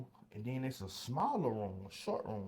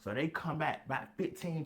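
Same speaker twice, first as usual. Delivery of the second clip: ordinary talk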